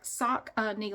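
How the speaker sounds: Saucony drawn out as SOCK-uh-nee; Google's pronunciation. Shown as a demonstration. Vlogger sounding out Google's version, SOCK-uh-nee, slowly. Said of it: "this is pronounced Saucony, like".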